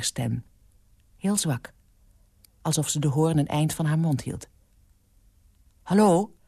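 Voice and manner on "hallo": louder phone hello; raised-voice hello; calling out. A narrator's reading. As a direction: call down the line. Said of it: "riep hij".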